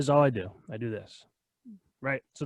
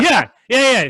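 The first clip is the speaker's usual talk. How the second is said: excited; 10 semitones higher, 9 dB louder, slower than usual. Real, not acted. High excited agreement.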